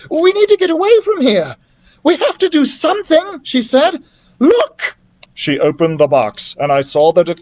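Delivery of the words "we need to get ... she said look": put-on high, urgent girl's voice; young girl voice. A narrator's reading. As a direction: voice her high and anxious. Beatrice voiced in story.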